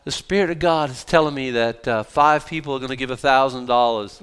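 put-on Southern preacher accent; Southern-accented appeal for big donations; mock Southern accent. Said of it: "We always drift into a Southern accent".

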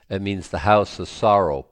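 ordinary delivery

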